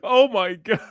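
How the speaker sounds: high, loud laughing exclamations of shock. Real, not acted. Co-host half laughing in shock at the glaring owner.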